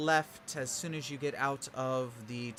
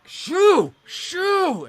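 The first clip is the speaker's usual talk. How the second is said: much higher, loud shout at the birds; shooing shout; 13 dB louder than usual. Acted. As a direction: chase the pests off with a yell. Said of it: "walks over to the door".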